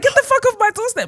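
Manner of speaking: very high-pitched mock yell; imitated angry shouting. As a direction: mimic an outraged homeowner chasing people off. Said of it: "proper shouting in this quiet area".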